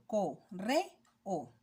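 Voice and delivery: co, re, o said as separate beats with pauses; split into syllables. Shown as a demonstration. Teacher showing the class the syllables of correo, each one apart. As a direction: tap out each syllable of co, re, o slowly. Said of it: "por ejemplo".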